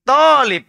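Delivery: teacher models "Talib" slowly, stretching the first vowel; correct Arabic long a. Shown as a demonstration. Teacher corrects a student: "Talib" with a long clear a. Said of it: "bukan tolib".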